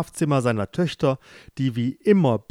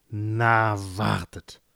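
low grumbled threat; grumbling; 3 semitones lower, slower than usual. Acted. King grumbling at his sleeping daughters, low grumble. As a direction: grumble it under your breath, lower. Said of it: "Der König grummelte".

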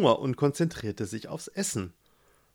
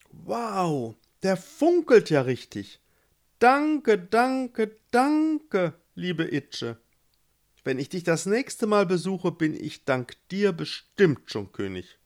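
delighted; 7 semitones higher than usual. Acted. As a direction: gush with joy over the ring. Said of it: "Gustav war begeistert". (Gustav bedankt sich begeistert und viel höher)

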